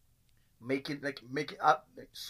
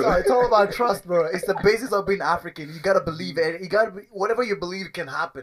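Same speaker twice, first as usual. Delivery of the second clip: louder, higher, emphatic; excited; 5 semitones higher, 8 dB louder than usual. Real, not acted. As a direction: preach it with gusto. Mock preaching about faith.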